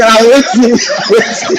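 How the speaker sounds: high, laughing waiting; laughing mock reply.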